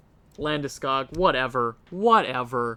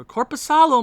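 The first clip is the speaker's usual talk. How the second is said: frustrated yell; 9 semitones higher, 6 dB louder than usual. Real, not acted. Fan yelling at goalie play.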